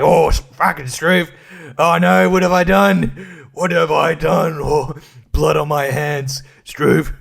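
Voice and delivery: slow, hammed-up horror at his own guilt; mock-anguished Prime Minister. Comedian voicing the Prime Minister regretting the flood, mock anguish. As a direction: wail it slowly, melodramatic and put-on. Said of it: "and he's like".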